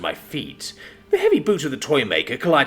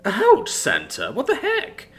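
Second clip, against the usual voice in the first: plaintive; 8 semitones higher, slower than usual. A narrator's reading. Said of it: "I said plaintively".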